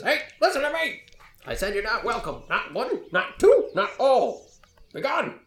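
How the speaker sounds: put-on high-pitched barking old-timer; crotchety yelling old man. Old man over his fence ordering adventurers away, barking crotchety yell. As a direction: bark each refusal like a guard dog. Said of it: "like a barking dog, and he yells out".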